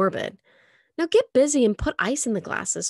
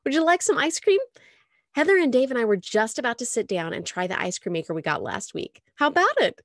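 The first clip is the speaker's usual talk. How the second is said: high, loud, bright offer; cheerful; 7 semitones higher, 5 dB louder than usual. Acted. Mom cheerfully invites Molly to ice cream, high and bright. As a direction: sell them on the ice cream. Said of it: "she said cheerfully".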